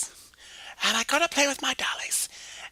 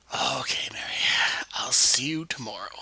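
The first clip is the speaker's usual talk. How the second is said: Jimmy voice; 10 semitones lower, 3 dB quieter than usual. Acted. Jimmy voice, low quiet.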